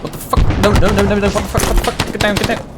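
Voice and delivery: high, frantic repeated cries; rising panic, words tumbling out; panicked cries. Real, not acted. Panic as ambush goes wrong.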